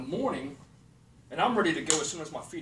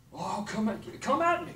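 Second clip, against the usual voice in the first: jumps much higher on come at me; defiant challenge; 4 semitones higher, slower than usual. Real, not acted. Daring his critics, defiant taunt. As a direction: taunt them to bring it on.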